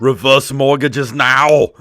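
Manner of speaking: loud, slow mock Austrian sales command; Arnold Schwarzenegger. Aging action star selling reverse mortgages, loud Austrian impression. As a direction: draw it out like an action catchphrase. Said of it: "he's gonna be selling, like, reverse mortgages".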